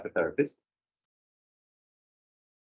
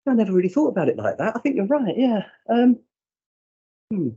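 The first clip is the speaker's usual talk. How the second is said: lightly voiced client, a touch higher; playing the young woman, a bit higher; client's voice; 9 semitones higher, 4 dB louder than usual. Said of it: "She went".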